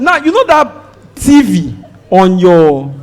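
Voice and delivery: ordinary talk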